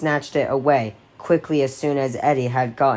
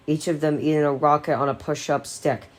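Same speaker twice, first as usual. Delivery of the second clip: ordinary delivery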